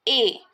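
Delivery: A as a closed French é; isolated, closed é for A; acute accent sound. Shown as a demonstration. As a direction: say A as a pure "ay" without a glide. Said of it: "the sound of acute accent is".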